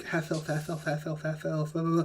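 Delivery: soft, rote repeated mumble; muttering while reading. Acted. Giant going through the pages of his book, muttering softly. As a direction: skim under your breath. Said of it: "he's, like, going through the pages".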